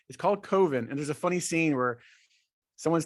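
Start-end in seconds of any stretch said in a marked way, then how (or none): 0.4-0.9 "Coven" said COH-ven; long-O coven. Shown as a demonstration. Host confirming the film's title, "Coven" with a long O. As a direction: stretch the O in "Coven" as in cove. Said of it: "no, Coven".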